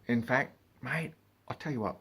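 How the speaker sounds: usual speaking voice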